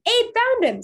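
high-pitched, loud shout. A narrator's reading. Someone spreading word of the found dog, shouting high. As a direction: shout the news through the woods. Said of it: "someone shouted".

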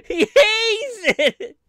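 high, loud, slow fake sob through laughter; mock wailing. Mourning the Ghostbusters fan's cursed fate, hammed-up wail.

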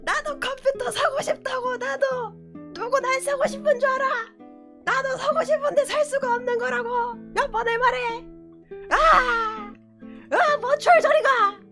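high whiny rant. Acted. High put-on ranting voice.

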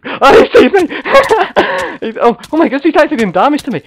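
high, loud laughing alarm; laughing panic. Real, not acted. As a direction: laugh in shock as he's hit. Spotted by enemy, laughing in alarm.

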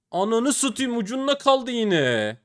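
high, slow exasperated wail; groaning at a near miss; frustrated cry. Real, not acted. Upgrade just missed.

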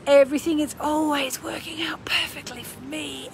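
hushed, breathy whisper; whispered affirmation. Shown as a demonstration. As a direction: whisper it like a secret promise. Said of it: "Let's do that".

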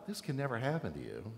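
soft and low, just saying the words; mild protest. Shown as a demonstration. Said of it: "it's pretty clear this is not a".

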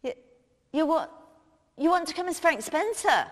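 ordinary talk

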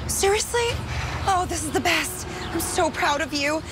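high delighted glee; gleeful. Acted. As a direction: revel in it gleefully. Delighted at his dumpster mattress.

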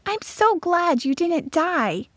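put-on high, slow, tender girl's voice; smiling girl. A narrator's reading. Florette tells her friend she's glad, tenderly smiling. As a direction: say it high with a smile. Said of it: "Florette said and smiled".

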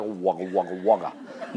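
low, wobbly wugga-wugga of a jiggling belly; goofy low body-wobble sound; jiggly wugga noise. Retold or imitated. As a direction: mimic a belly bouncing on bumps. Body jiggling over bumps.

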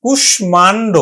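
কুষ্মাণ্ড with the m sounded after ষ; কুষ্মাণ্ড as in Sanskrit; correct pronunciation. Shown as a demonstration. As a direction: model কুষ্মাণ্ড, ʃm clearly. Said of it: "উচ্চারণ হবে".

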